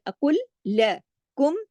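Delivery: अकुल लकुम with the lam held twice, slow; doubled lam. Shown as a demonstration. Teacher shows the shadda to students, slow. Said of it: "तशदीद है ना ... दो लाम है".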